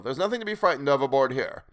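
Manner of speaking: exclaiming. A narrator's reading. Will reassuring the frightened stranger, exclaiming. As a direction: call it out to reassure. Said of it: "exclaimed Will".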